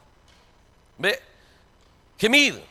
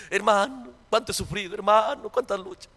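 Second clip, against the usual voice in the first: mock whining believer; 7 dB quieter than usual. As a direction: sigh it out like a sufferer. Complaining believer mimicked again.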